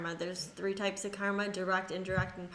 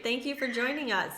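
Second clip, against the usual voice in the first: ordinary delivery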